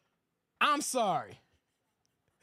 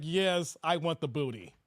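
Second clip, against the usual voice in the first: much lower, growling mock lust; mock leering low voice; deep predatory voice; 8 semitones lower than usual. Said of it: "he looked like he's get-, it's like".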